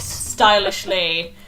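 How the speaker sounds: normal talking voice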